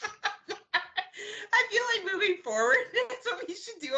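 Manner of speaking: high voice breaking into real laughter; still laughing. Real, not acted. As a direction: push the words out through giggles. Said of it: "I'm still laughing at the thumbs up".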